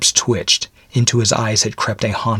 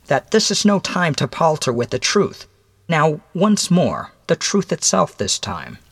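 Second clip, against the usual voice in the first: higher-pitched, deliberate stranger's voice; measured visitor addressing a condemned man; stern mysterious visitor; 8 semitones higher than usual. Acted. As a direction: spell out the grim facts in a higher voice.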